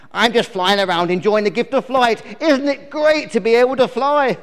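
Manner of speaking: high, loud buzzy insect character; delighted little fly; cheerful fly. Acted. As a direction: enthuse as a carefree bluebottle.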